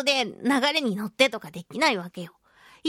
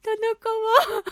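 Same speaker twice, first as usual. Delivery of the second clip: mock tearful apology; 6 semitones higher than usual. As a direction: plead meekly, then trail off. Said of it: "って思いながら".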